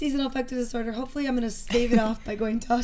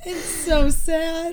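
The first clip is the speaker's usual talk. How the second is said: slow, drawn-out mock sympathy; mock pity; 5 semitones higher, 6 dB louder, much slower than usual. Real, not acted. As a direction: stretch it out in fake sorrow.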